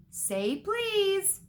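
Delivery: high, teasing sing-song. A narrator's reading. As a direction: taunt with a lilting high tune. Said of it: "in his annoying sing-song voice".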